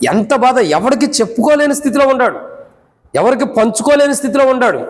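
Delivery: high-pitched, urgent sermon delivery; impassioned preaching. Real, not acted. Preacher on a man unable to tell anyone his shame, impassioned preaching.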